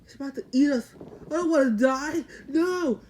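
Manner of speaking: rising hammed-up terror ending in a shrill no; mock panic. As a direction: act out the crowd panicking about being eaten. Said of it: "everybody's scared".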